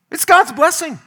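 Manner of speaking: high and loud, hammering it home; emphatic declaration. Acted. Preacher to congregation, high and loud proclaiming God's blessing.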